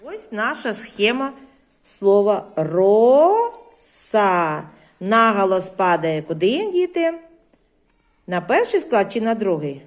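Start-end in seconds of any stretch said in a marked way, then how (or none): 1.8-4.6 slow teaching. Shown as a demonstration. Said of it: "teacher shows the word slowly".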